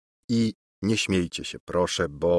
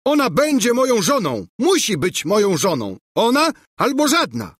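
very high, frantic bellow; hammed-up furious tantrum; roaring; 12 semitones higher, 4 dB louder than usual. A narrator's reading. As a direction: insist at the top of your pitch. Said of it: "ryczał Bulbo".

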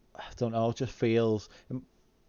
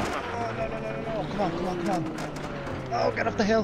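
psyching myself up; 7 semitones higher, much faster than usual. Real, not acted. Tense moment on a rally stage.

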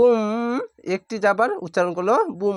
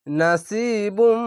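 slow demo reading; 3 semitones higher, much slower than usual. Shown as a demonstration. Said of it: "teacher reads the word slowly".

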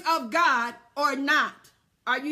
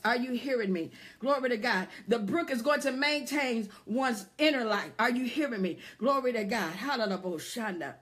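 lower, softer praise between sermon points; hushed, low preacher's interjections; subdued preaching; 4 semitones lower, 4 dB quieter than usual. Real, not acted. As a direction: let the sermon settle into quiet praise.

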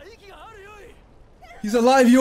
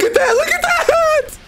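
building from usual pitch to a high scream; mounting excitement; 14 semitones higher than usual. Real, not acted. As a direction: repeat it climbing into a laughing shriek. Reacting to the transformation.

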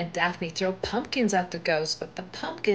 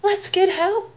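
much higher, louder panicked call; alarmed cry; 12 semitones higher, 5 dB louder than usual. Acted. Someone seeing the burning cornfield, higher and loud with alarm. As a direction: sound the alarm at the fire.

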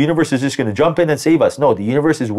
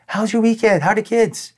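high, put-on small-talk voice; breezy deflection; 9 semitones higher than usual. To a coworker, diverting from gossip, breezy small talk. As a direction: chirp harmless questions to steer away.

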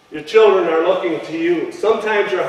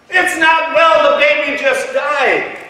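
high, loud, slow anguished wail; anguished cry; 8 semitones higher, 5 dB louder than usual. Retold or imitated. Preacher voicing the grieving mother's cry, high and loud. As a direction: cry out in grief.

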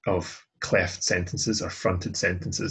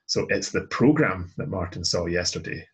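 "program" punched, the rest more lightly said; stress on PROGRAM. Shown as a demonstration. Teacher contrasting program with a film, stressing "program". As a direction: pick out "program" against any alternative. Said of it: "bringing am program to the front".

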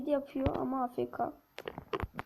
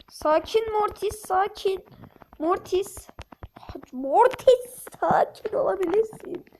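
mock soothing; 5 semitones higher, 3 dB louder, slower than usual. Calming teammate Mortis playfully.

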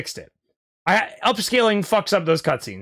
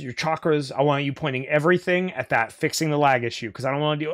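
ordinary delivery

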